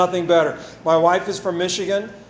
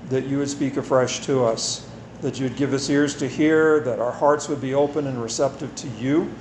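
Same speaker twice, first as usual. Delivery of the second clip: prayer; 4 semitones lower than usual. Acted. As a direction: pray it gently and humbly. Leads congregation in prayer.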